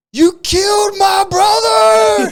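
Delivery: high, drawn-out mock scream; imitated yell, stretched and shrieked; retold anguished yell. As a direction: holler it out full blast, imitating the enraged brother. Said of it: "yelling at him".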